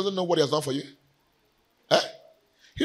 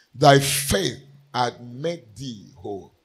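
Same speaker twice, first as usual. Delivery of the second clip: slow emphasis; 3 semitones lower, slower than usual. Shown as a demonstration. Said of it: "A very important point".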